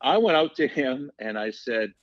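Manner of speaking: ordinary talk, nothing marked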